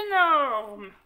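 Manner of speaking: "énorme" drawn out, falling; emphatic ÉNORME. Acted. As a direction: stretch "énorme" to show the size. Rabbit exaggerates the hair's size. (« énorme » étiré avec emphase)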